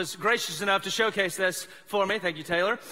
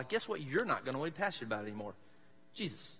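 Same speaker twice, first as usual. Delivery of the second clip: usual speaking voice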